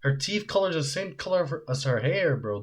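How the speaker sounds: ordinary talk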